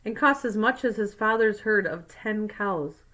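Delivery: plain reading voice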